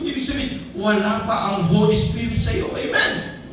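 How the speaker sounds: high, fervent preaching; preaching at full force. Acted. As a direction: build to a triumphant amen. Preaching at rising pitch.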